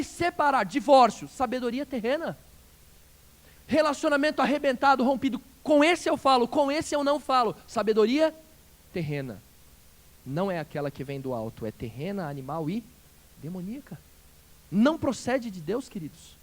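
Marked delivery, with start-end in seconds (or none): none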